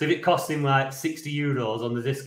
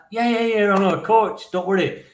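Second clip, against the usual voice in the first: retold eager reassurance; 7 semitones higher, 3 dB louder than usual. Acts helpful stranger reassuring him.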